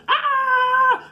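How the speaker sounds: very high, loud shriek; hammed-up scream of someone being attacked; piercing imitated cry; mock scream. Acts out neighbor screaming in fight.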